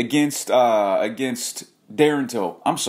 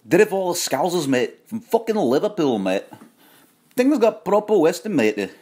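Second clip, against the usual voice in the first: hammed-up mock Scouse accent, higher; rough Liverpool Scouse put-on, pitched up and punchy; 7 semitones higher than usual. MMA vlogger showing off to Liverpool fans in mock Scouse accent. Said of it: "How's my Scouse accent?".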